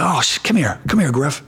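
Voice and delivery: high-pitched, welcoming retold call; calling over. Star player calls the coach's son for a picture, calling out. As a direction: wave the boy over with your voice.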